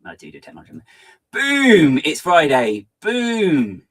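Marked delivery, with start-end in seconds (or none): 1.3-3.8 loud, punchy Friday shout; mock shout. Streamer reads Andy's chat greeting as a loud shout. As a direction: belt it out like a hype man. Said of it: "says Andy".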